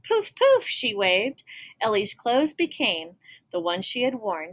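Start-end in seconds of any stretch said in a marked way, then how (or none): 0.1-0.7 high, loud magic poofs; squeaky magic wand sounds. Acted. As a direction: pop them like a spell. Magic spell.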